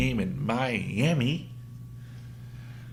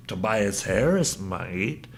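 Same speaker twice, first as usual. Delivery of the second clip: normal talking voice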